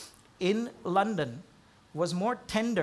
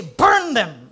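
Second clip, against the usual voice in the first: loud, sharp order to destroy; a sudden loud imagined outburst; mock angry command; 3 semitones higher, 13 dB louder than usual. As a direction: fling it out as an imagined furious verdict.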